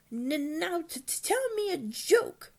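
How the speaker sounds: high, stuttering little dragon voice; high, drawn-out creature stutter; Toothless. A narrator's reading. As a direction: stammer out a demand in a squeaky voice. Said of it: "Toothless has a little bit of a stutter".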